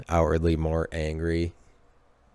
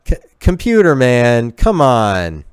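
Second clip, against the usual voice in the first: loud, higher cry of protest; mock exasperated shout; 4 semitones higher, 9 dB louder than usual. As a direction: call him out loudly in mock exasperation. Mock scolding of Computer Man.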